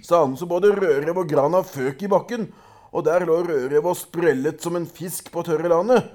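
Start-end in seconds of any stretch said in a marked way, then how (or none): none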